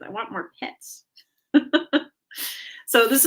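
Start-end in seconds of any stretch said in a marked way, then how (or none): 0.0-1.0 soft, put-on cat voice begging; a lightly voiced demanding cat; voicing the cat. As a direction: speak for the cat, quiet and needy. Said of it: "no, she says".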